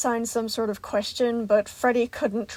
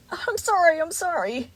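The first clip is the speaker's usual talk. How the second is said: tearful apology; 8 semitones higher, 3 dB louder than usual. A narrator's reading. Gregory crying, apologising.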